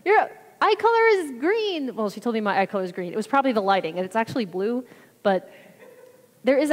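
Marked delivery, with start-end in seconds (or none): 0.0-1.9 high, drawn-out imitation of wonder; imitating amazement. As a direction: retell it as an astonished, excited exclamation. Retelling a healed woman's amazement.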